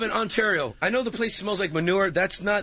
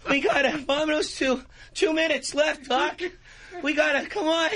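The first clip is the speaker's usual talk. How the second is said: Michael J. Fox; 8 semitones higher than usual. Acted.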